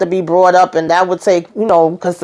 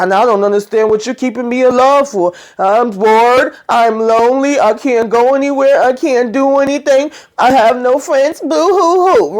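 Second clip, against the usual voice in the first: sing-song mock complaint climbing higher; mock whining; 4 semitones higher than usual. Stella complaining to the man keeping her alive, mock whining. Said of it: "Stella coming in freaking complaining and whining".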